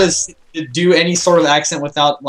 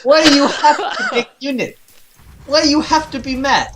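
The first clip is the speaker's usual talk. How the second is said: much higher mock Russian accent, giggling; Russian accent through laughter; 8 semitones higher than usual. Caster doing a Russian voice but laughing through it. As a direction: push a Russian accent while cracking up. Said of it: "You can't do it without laughing".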